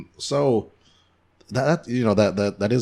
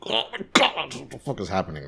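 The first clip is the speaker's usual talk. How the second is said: mock tirade; 6 semitones higher than usual. Host re-enacting his panic episode, mock tirade. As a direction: imitate your own panicked outburst, voice dropping away. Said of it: "a mental or physical tirade".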